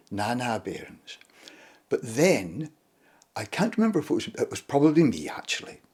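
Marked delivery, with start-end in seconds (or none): 0.1-1.2 put-on quiet Shetland accent, last word almost whispered; gentle Shetland crofter, hushed and unhurried; soft Shetland accent. Gentle crofter calming the children, soft Shetland accent. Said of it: "all he had to say was".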